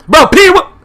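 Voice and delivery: so worked up the name won't come out; sputtering outburst. Real, not acted. Fan too worked up about the defense to finish the name, sputtering outburst. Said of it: "I can't even get it out".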